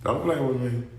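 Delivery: soft, low threat; low quiet warning. Real, not acted. Angry vlogger warns the ghoster quietly. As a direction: warn him quietly. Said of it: "repeated warning".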